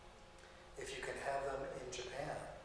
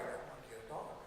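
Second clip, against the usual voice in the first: usual speaking voice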